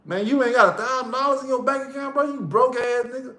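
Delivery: high-pitched mock berating; imitated trash talk. Homeboy dogging a broke friend, mock scornful. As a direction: ride a buddy hard in a high, needling voice. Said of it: "That's how they talk to you".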